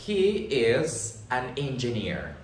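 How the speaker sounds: slow, carefully spaced English model sentence. Shown as a demonstration. Teacher models an English sentence for Spanish learners, slow and careful. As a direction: lay each word out slowly for learners. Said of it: "por ejemplo".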